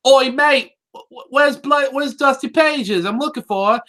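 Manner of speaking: a hammed-up Cockney asking for Dusty Pages, high; mock Cockney pony. Retelling the pony asking the librarian, mock Cockney. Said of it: "then she's like".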